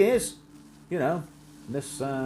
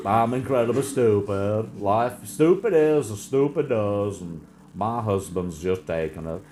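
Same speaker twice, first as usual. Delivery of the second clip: slow, dopey mock voice, a little louder; mock widow; 3 semitones lower, 4 dB louder, slower than usual. Mocking the widow who sued Trump, slow and dopey. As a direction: lampoon her as dim-witted.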